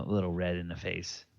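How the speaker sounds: ordinary delivery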